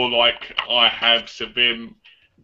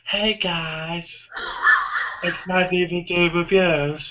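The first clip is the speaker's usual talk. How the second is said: high, soft camp vlogger voice; slow, drawn-out mimicked intro; James; 4 semitones higher, 4 dB quieter, much slower than usual. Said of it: "that's James all over".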